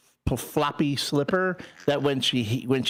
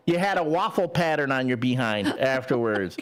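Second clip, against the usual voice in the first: ordinary delivery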